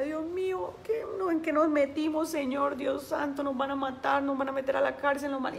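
high, anxious pleading to God; retold tearful panic. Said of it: "yo lloraba y yo decía". (súplica angustiada y aguda)

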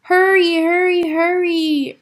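loud, high, insistent call; urging. A narrator's reading. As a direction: urge a friend along loudly. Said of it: "urged Bear".

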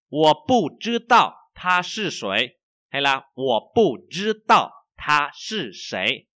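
slow, loud, clear Chinese to repeat after; slow model reading. Shown as a demonstration. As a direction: read it out slowly for students to echo.

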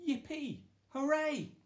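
high, bright whoops of birthday joy; excited cheer. Acted. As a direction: cheer like a child on his birthday. Birthday joy, jumping out of bed.

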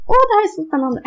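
high and bright with excitement, rising up; excited squeal. Real, not acted. Gamer thrilled as triplets are born, excited high squeal. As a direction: gush over the newborn, genuinely thrilled.

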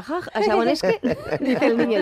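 real laughter breaking into the words, pitch jumping high; laughing. Real, not acted. Telling friends about Prince Charles, laughing. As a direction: giggle through it, barely able to go on.

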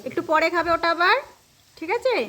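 high, loud sing-out to a little one. Real, not acted. Mother telling child to eat it later, high and loud. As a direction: pitch it way up, loud, seeking agreement.

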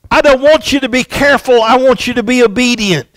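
loud, high, fast rebuke; scolding yell. Retold or imitated. Neighbor scolding Johnny from the window, loud and fast. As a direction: lay down the law. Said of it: "she yelled at him and said".

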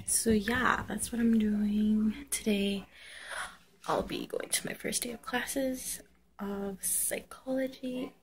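soft steady whisper. Real, not acted. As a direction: keep it to a hush. Quiet morning talk.

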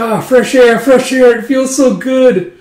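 high, loud exclamations of relief; acted joyful release; elated relief. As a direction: revel in it, stretching the last words. Acted relief at escaping cover.

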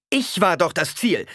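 put-on high, loud indignant girl; Alia, indignant. A narrator's reading. Alia protests to her mother, high and indignant. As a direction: insist you were the target.

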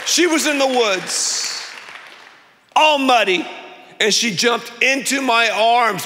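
high, drawn-out triumphant telling; joyful announcement. Real, not acted. Pastor announcing the lost dog was found, high and joyful. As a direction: proclaim the happy ending.

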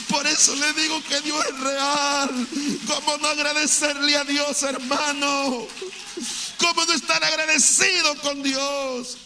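exclaiming gratitude, pitch lifted; fervent preaching. Real, not acted. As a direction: proclaim it to the congregation, stretching the last words.

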